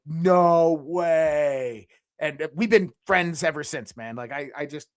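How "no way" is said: stunned no way. Retold or imitated. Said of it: "I started fucking like".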